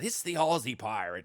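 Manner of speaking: lightly voiced pirate taunt; pirate impression. Rival crew spotting the Aussie player, light pirate impression. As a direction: mimic a rival pirate calling him out. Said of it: "they're acting like pirates".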